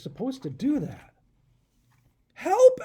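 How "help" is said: high, loud cry for help; scream. Acted. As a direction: shriek it out in panic. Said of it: "Adam screamed".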